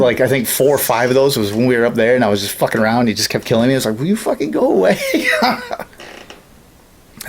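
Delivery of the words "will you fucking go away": high-pitched mock plea, laughing; laughing exasperation. Player to an opponent who kept killing him, laughingly exasperated. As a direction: plead through a laugh, exasperated. Retold plea to a relentless killer.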